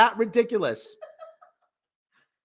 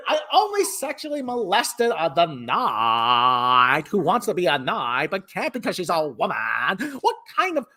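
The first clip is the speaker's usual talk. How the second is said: put-on Valley Girl accent; 3 semitones lower than usual. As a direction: stretch key words like a Valley Girl. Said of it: "have a Valley Girl accent".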